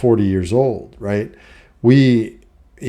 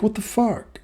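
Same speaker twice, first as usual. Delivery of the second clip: high, softer baffled reaction; incredulous; 12 semitones higher, 7 dB quieter than usual. Retold or imitated. Son reacting to mom's order, high and baffled. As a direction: disbelief pitched way up. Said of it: "and I'm like".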